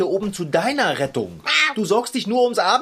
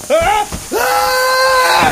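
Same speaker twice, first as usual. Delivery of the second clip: falling scream; 7 dB louder than usual. Acted. Falling from the balloon.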